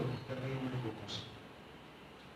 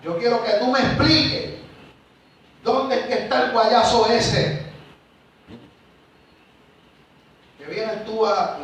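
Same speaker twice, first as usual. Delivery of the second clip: loud, higher, accusing yell; angry shouting coworker; 8 semitones higher, 9 dB louder, slower than usual. Retold or imitated. Irate coworker confronting the guard on patrol, retold as a loud yell. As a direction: bark the demand like an angry coworker. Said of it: "Y siguió levantándome la voz".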